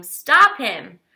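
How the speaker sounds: loud shout of stop him. A narrator's reading. Man chasing a thief, shouting. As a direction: call it out after the thief.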